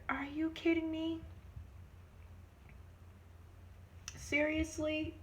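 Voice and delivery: high, incredulous; can't believe it; disbelief. Real, not acted. Reacting to the doctor's denial with high disbelief. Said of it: "reacting to denial".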